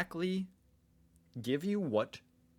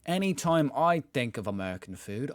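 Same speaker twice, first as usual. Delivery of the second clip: put-on Australian accent; 2 semitones higher, 4 dB louder than usual. Rereading the Reddit comment in a put-on Australian accent. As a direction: reread the line as a hammed-up Aussie. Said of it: "I'm done with the accent".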